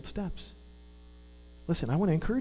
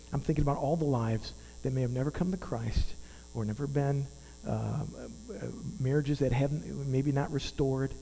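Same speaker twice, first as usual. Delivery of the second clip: ordinary talk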